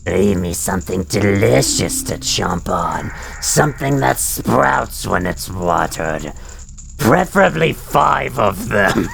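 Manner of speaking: slow, low and hollow undead groan; low mummy voice. Acted. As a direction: drag each word like a mummy. Reading Gibdo requests aloud.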